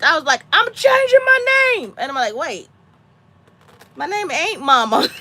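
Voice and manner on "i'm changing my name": high, loud retold shriek; exasperated mock scream; stressed outburst. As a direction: act out snapping under stress, shouting high. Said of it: "I got so stressed".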